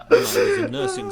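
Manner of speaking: high, loud giggling words; laughing. Real, not acted. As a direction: still laughing while starting. Laughing fit continues.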